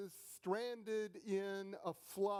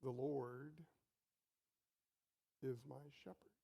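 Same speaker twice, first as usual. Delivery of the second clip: soft reverent; 5 semitones lower, 6 dB quieter than usual. Shown as a demonstration. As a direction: let it fall softly, like a prayer.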